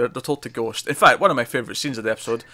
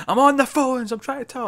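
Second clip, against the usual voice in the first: high-pitched frustrated yell, not full volume; mock scream; 11 semitones higher than usual. Frustrated phone worker screaming at the patients, high mock scream. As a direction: imitate a harried high screech. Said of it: "scream at the patients".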